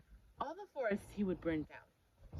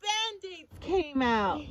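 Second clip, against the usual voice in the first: high, slow drawn-out reveal; big reveal; 8 semitones higher, 6 dB louder, slower than usual. Acted. Reader revealing Band-Aids to the child. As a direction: savor the surprise.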